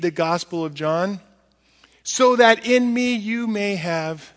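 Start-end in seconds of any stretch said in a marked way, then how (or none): 2.0-4.3 emphatic quote. Acted. Preached quote.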